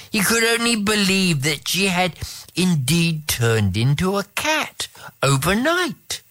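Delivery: ordinary delivery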